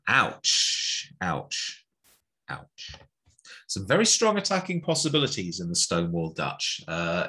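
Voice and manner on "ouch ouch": normal talking voice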